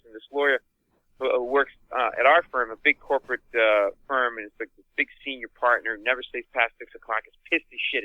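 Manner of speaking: usual speaking voice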